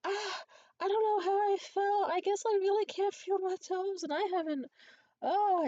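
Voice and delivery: high, loud, faked bewilderment; put-on innocent distress. Pretending not to know how she fell, high feigned distress. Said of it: "I did a pretty good impression of".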